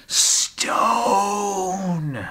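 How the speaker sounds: high, long wailing cry on one word; drawn-out wail. Acted. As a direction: stretch it out high, then let it fall. Dramatic peak of dark poem.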